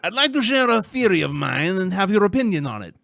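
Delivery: loud, high, hammed-up French accent; badly faked, over-the-top French accent; obnoxious French accent. Host reads listener's email as requested, obnoxious French accent. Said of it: "your most obnoxious French accent".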